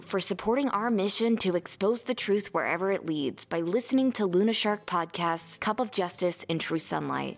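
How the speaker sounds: soft, upbeat podcast ad read. Acted. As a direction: read it like a friendly sponsor spot. Sponsor ad read.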